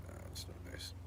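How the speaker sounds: whispered aside; soft unvoiced whisper. Real, not acted. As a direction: hush it to yourself. Scolding himself under breath.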